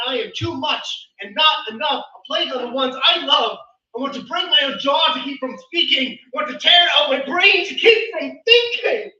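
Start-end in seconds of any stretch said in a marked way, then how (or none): 2.0-9.1 anguished build. Acted. Poet wants to stop speaking and thinking, anguished shouting. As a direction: drive each line harder until it peaks in a shout.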